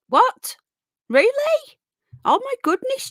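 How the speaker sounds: high-pitched, rising disbelief; real surprise. Real, not acted. Streamer reacts to news from a viewer, genuinely surprised.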